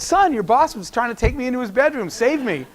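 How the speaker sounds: playfully imitating an alarmed mother; mock alarmed plea. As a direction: plead comically to be saved. Mother's retold plea to her son.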